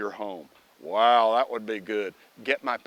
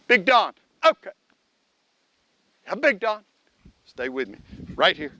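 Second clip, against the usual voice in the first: praising the dog; 7 semitones higher, 6 dB louder than usual. Real, not acted. Talking to his dog.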